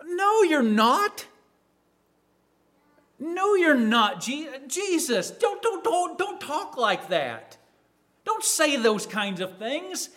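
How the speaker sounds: high-pitched, slow, stammering protest; protesting Peter. Acted. Disciple objecting to talk of rejection, high and protesting. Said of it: "what does Peter do?".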